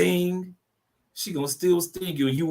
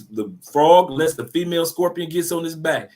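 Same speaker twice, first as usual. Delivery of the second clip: ordinary talk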